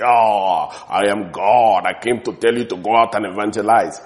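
loud, low groaning voice from a dream; mock booming God. God supposedly bursting into a dream, mock booming voice. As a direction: proclaim it as a hammed-up voice of God. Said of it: "You will not hear God talk like that".

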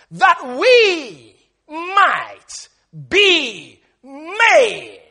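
very loud word-by-word proclamation; drawn-out, high, thundering scripture delivery; full-force preaching. Acted. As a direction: launch each word high and let it slide down slowly.

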